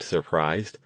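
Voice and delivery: ordinary delivery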